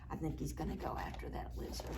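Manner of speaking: fast hushed whisper; whispered aside. Real, not acted. Teacher whispering to the children about the cat, fast.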